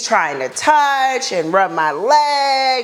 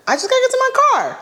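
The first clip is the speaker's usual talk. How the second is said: much higher, fast desperate repeat of "I just gotta get to my car"; frantic high-pitched "I just gotta get to my car"; panicked squeal; much faster than usual. Retold or imitated. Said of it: "Okay, Lord".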